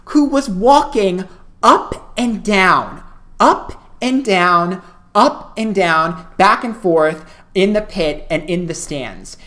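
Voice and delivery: slow, weighty repetition for comic effect; dramatic drawn-out emphasis. Real, not acted. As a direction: stretch each phrase out like endless pacing. Drawn-out dramatic reveal of repeated pacing.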